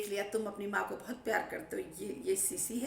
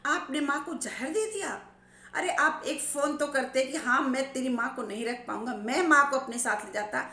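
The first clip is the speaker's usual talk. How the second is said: loud raging rebuke; angry shouting; 2 semitones higher, 4 dB louder than usual. Retold or imitated. Son shouting at his father for failing his mother, furious. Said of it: "बेटी भी चिल्ला रही है".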